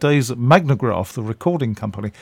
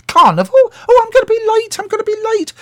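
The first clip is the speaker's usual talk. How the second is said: much higher, louder put-on voice of an elderly actress in a fluster; Patricia Hayes; 8 dB louder, faster than usual. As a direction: wail it up high that she'll be late. Star fretting in the car.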